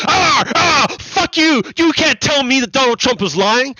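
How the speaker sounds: mock angry viewer. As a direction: ham up an indignant Fox viewer who won't hear it. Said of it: "the viewers of Fox News are going".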